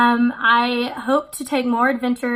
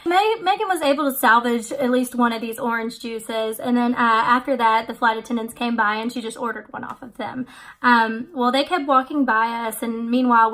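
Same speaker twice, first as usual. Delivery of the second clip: ordinary talk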